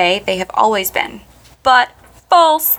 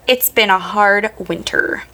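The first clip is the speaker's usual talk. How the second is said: slow rhotic American accent. Shown as a demonstration. Host contrasting with the Brit, American accent. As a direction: say it as an American, R's pronounced. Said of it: "an American would say".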